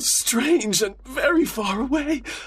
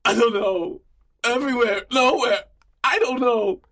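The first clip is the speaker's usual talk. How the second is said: high, tearful helplessness; distraught prisoner, voice pitched up; acted weepy, frightened answers; crying; 12 semitones higher than usual. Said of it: "Ballard was crying now".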